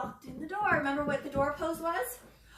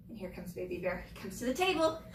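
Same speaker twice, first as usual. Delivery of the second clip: normal talking voice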